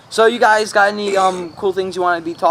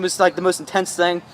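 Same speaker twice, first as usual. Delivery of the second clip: usual speaking voice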